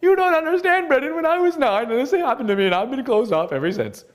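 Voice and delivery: mock wounded complainer, high and pleading, then sagging; whiny excuse voice. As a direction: whine it like someone clinging to an old hurt. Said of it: "if you're a person like".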